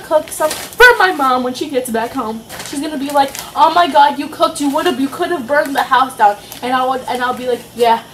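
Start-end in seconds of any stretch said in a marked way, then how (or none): none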